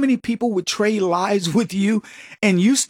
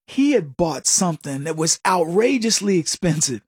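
usual speaking voice

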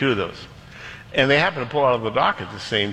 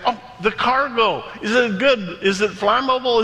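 high, quick-thinking excitement; light up with a sudden idea; acted-out eager captain; 10 semitones higher than usual. Captain seizes on a new idea.

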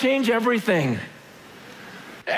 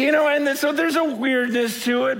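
ordinary talk

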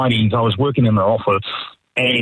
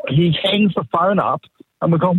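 ordinary talk, nothing marked

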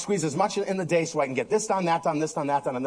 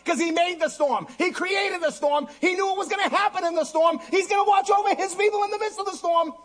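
full-force preaching; 13 semitones higher than usual. Real, not acted. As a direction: preach it at full pitch, driving hard.

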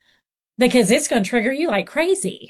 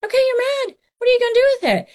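put-on much higher, bright coaxing voice for a little boy; talking to a child; much faster than usual. Counselor asking a boy who lost at Candyland what to do, high and coaxing. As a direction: cheerfully ask the kid what he'll do with his mad. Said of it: "I said".

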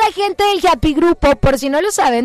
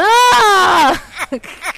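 loud bursting laugh; hearty loud laughter; 5 semitones higher, 4 dB louder than usual. Real, not acted. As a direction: crack up loudly. Laughs at daughter impression.

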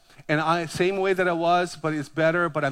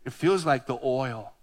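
softer, slow, hushed quotation; soft, reverent reading; 5 semitones lower, 5 dB quieter, slower than usual. A narrator's reading. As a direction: savour the psalm gently. Quoting David's psalm.

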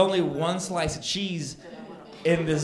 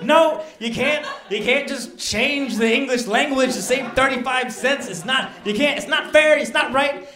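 high, loud, exasperated pleading; indignant protest; 9 semitones higher, 6 dB louder than usual. Retold or imitated. Customer arguing with corporate over cheese, indignant protest. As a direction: protest like a wronged customer losing it. Acted phone quarrel with corporate.

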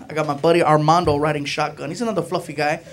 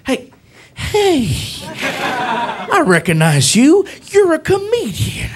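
slow, swooping high-pitched recognition; imitated police officer; 7 semitones higher, slower than usual. Officer recognizing a comedian, slow imitated drawl. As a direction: play a cop slowly placing a famous face.

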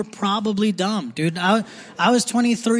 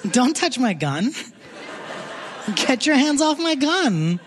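high, slow warning; 6 semitones higher than usual. Pretend.

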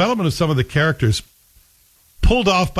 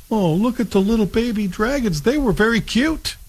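high-pitched gushing coo; playfully smitten, high voice; cooing over babies; 9 semitones higher than usual. Acted. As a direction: coo at something tiny and cute. Reacting to baby dragons' cuteness.

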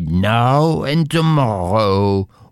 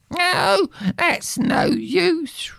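very high reproachful wife; high, wailing wife; slower than usual. Acted. Wife reproaching her husband, high wailing voice. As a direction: plead and reproach him.

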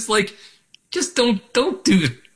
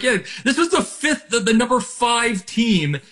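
laughing through a mocking quote; high, amused mock brag; 9 semitones higher than usual. Mocking a team's title claim.

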